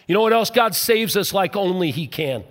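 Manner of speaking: ordinary talk, nothing marked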